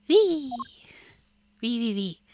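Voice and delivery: high, loud squealed "wee"; giddy whooping; gleeful wee. Acted. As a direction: squeal with childlike glee, then repeat lighter. Gleeful squeal while following along.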